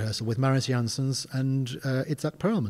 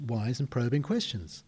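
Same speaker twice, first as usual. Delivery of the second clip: normal talking voice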